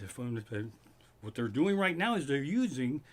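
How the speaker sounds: normal talking voice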